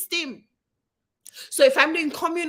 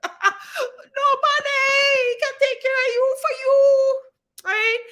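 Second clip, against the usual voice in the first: high, loud laughing shout; a loud laughing cry way up high; laughing exclaim; 12 semitones higher, 4 dB louder, slower than usual. Real, not acted.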